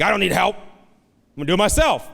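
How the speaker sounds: a proud father refusing help; mock stubborn old man; stubborn dad.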